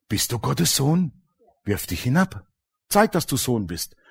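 soft, coaxing devil; devil's soft temptation, understated; tempting devil voice. Retold or imitated. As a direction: lure him in quietly. Devil tempting Jesus quoted.

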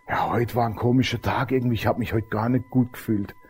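low, soft voiced reply; understated, quiet murmur of unease; subdued neighbour. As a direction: play a man lightly puzzled by feeling off. Unwell neighbour answers, retold.

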